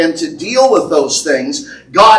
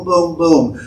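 ordinary talk

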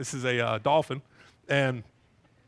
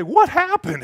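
sudden high, loud "what happened"; bewildered cry; 10 semitones higher, 7 dB louder, slower than usual. Pretend. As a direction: snap out of it, confused. Acted bewildered self-quote.

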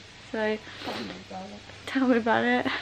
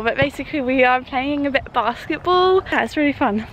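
ordinary talk

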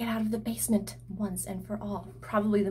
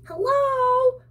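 high, loud call down the hallway; Audrey screaming for whoever is in danger; screamed; 7 dB louder than usual. A narrator's reading. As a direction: shout it out while racing. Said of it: "Audrey screamed".

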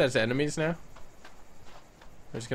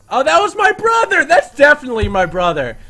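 much higher, louder sudden realization; surprised shout; 11 semitones higher, 12 dB louder than usual. Real, not acted. Spotting the brother raptor in the game, surprised loud shout. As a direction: blurt the discovery.

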